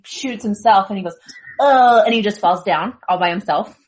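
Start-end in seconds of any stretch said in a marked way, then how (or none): 1.6-2.0 higher, louder play-acted groan; mock death groan. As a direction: groan as if playfully shot. Child's pretend death groan retold.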